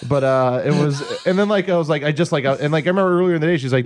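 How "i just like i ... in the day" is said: ordinary talk, nothing marked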